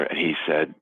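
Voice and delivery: ordinary talk, nothing marked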